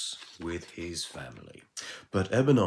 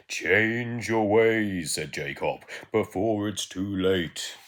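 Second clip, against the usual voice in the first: slow, loud, solemn ghost warning; ghostly warning; 3 semitones higher, 7 dB louder, slower than usual. Acted. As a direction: boom it out slowly like a ghost. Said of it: "ghost warns Ebenana".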